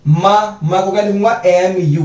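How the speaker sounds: ordinary talk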